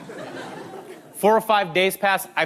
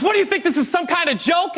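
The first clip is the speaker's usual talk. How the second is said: high-pitched indignant challenge; mock pissed-off; 9 semitones higher than usual. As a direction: demand answers as an imitated angry woman. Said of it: "Marie got pissed. She was not happy".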